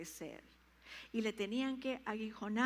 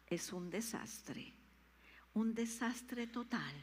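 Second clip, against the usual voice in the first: slow emphasis; 3 dB quieter, slower than usual. Acted. Predicador enfatiza.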